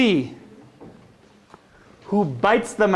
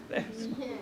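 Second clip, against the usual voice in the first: tiny voice; 2 semitones higher, 14 dB quieter, faster than usual. Acted. Showing children tiny flea.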